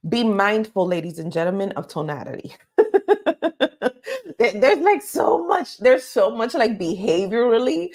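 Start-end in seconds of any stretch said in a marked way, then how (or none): 2.8-4.3 laugh. Real, not acted. Laughing during lesson.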